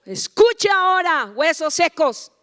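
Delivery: loud, high, drawn-out proclamation; prophetic command. Acted. Preacher addressing the dry bones, commanding proclamation. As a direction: summon the dry bones with authority.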